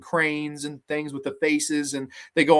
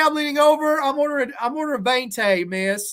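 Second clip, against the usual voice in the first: mock indignant rant; 8 semitones higher than usual. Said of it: "he was so fucking pissed".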